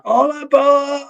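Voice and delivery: high, drawn-out mock rock-singer yell; imitated high-pitched stage cry. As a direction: holler it out high and long, imitating a frontman. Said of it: "he yells out".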